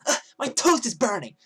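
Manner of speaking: loud, high indignant whine; bitchy attitude. Retold or imitated. Girl yelling at the kiosk guy about toast, bitchy attitude. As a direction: imitate her rude yelled complaint. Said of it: "This is the exact attitude she was having".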